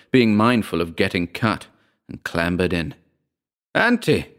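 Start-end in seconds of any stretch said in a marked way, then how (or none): 3.7-4.3 high-pitched urgent call, not full volume; contained narrator's shout, sharply raised pitch. A narrator's reading. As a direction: call out to her in alarm, voice jumping up. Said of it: "I shouted".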